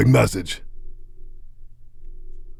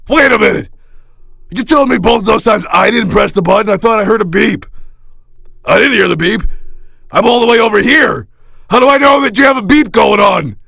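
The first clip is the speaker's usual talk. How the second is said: exasperated protest; 7 semitones higher than usual. Acted. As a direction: push back, rising into a shout. Annoyed star argues about beep.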